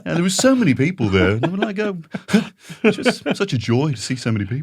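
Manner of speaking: high, loud, fast, laughing Scouse-ish celebrity gush. Retold or imitated. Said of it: "Got [name] there".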